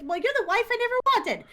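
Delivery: very high, fast mock retort; high comic outrage tipping into laughter; acted-out yelling. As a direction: fire back in a play quarrel. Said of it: "they started yelling at each other".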